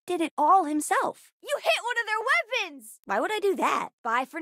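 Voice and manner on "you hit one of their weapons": high, urgent shout of news; high-pitched combat cry; excited battle call-out. Acted. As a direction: report the hit, thrilled.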